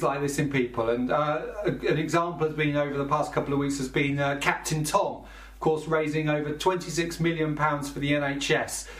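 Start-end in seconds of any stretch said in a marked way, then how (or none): none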